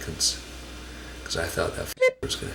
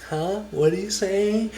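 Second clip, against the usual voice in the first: confused; 12 semitones higher, slower than usual. Retold or imitated. Colette confused by robber.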